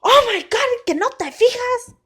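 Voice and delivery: high, loud exasperated dad; loud mock telling-off; exasperated scolding outburst. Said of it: "But not yelling at me".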